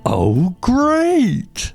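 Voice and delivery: high-pitched imitation of a pleased crowd; mock delighted listeners. Host imitating listeners told it is this afternoon, delighted. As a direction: coo it very high, as the excited fans. Retelling listeners' reaction to news.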